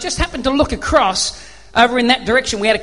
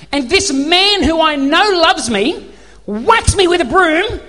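slow, higher, emphatic voicing of a grateful cat; cat's delighted thoughts; 5 semitones higher, 3 dB louder, slower than usual. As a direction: proclaim it slowly as the overjoyed cat. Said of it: "what's the cat thinking in that moment?".

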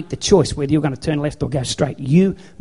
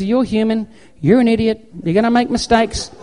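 higher-pitched forgiving sat-nav; easygoing GPS; 7 semitones higher, 5 dB louder than usual. Acted. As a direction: shrug off the mistake as a patient GPS character. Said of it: "the G P S goes".

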